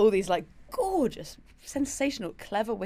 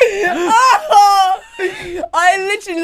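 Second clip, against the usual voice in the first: loud, laughing shriek of delight; laughing scream; 13 semitones higher, 16 dB louder, much slower than usual. Real, not acted. Guest hearing her crush's reply, shrieking with laughter. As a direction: squeal with laughter at the text. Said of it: "reacting to his text".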